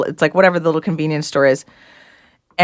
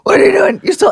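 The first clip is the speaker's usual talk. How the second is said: angry dad; 13 semitones higher than usual. Retold or imitated. Dad catches child with stolen confetti.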